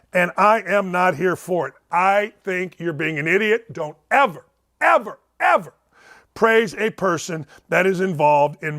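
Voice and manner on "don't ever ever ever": escalating stern warning; emphatic ever. Real, not acted. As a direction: hammer the ever repeats. Scolding Trump emphatically.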